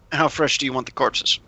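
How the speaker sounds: usual speaking voice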